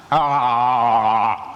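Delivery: mock monster growl; a hammed-up little roar; playful fake scare. Monster trying to scare kids.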